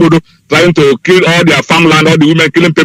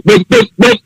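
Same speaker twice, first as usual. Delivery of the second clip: mock gunshots. Three bulls for the leader.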